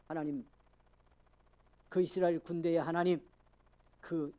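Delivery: loud scripture proclamation; impassioned preaching. Real, not acted. Preacher proclaiming David's confession before Goliath, impassioned preaching. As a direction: declare the verse forcefully to the congregation.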